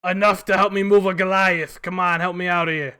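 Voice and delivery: put-on loud old goblin asking for help; gravelly sly hobgoblin.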